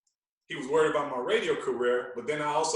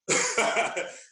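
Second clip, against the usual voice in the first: airy high laugh; a quick breathy laugh; 9 semitones higher than usual. Real, not acted. Laughing at showing up unannounced at the hospital. Laughing about surprise visit.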